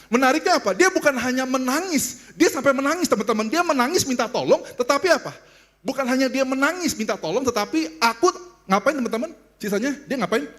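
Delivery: high, impassioned preacher's voice; preaching. Real, not acted. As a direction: press it urgently on the congregation. Preaches with raised voice. (khotbah berapi-api dengan nada tinggi)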